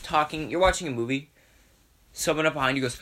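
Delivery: usual speaking voice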